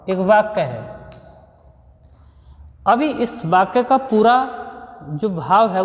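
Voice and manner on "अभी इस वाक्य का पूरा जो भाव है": ordinary talk, nothing marked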